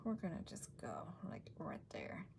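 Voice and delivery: soft unvoiced whisper while concentrating. Real, not acted. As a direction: breathe it as you place the stamp. Whispering while lining up stamp.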